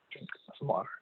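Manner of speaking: a quick breathy whisper to herself; whispered aside. Real, not acted. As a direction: mutter it under your breath, unvoiced. Aside to self mid-talk.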